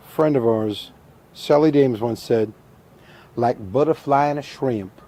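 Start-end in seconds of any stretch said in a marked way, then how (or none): 3.4-5.0 put-on southern accent. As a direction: quote a friend with a hint of her Southern accent. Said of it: "She had that beautiful little southern accent".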